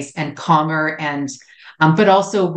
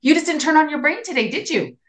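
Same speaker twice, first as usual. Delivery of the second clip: quick, high, sarcastic telling-off; re-enacted exasperated parental jab; retold mad scolding; 11 semitones higher, faster than usual. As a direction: needle the child in a raised pitch. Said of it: "I was so mad".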